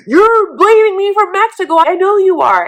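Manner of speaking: high, loud accusing whine; hammed-up petulant accusation; whiny Andrew. Andrew accusing Amira over Mexico, mock high whine. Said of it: "And he was like".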